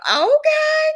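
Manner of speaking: high squealed okay; high-pitched amazed okay; mock impressed okay. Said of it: "Tessa was like".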